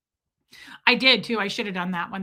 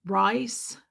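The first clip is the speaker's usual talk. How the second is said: dad's southern drawl, lightly imitated; 2 semitones lower than usual. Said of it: "he's got that southern drawl".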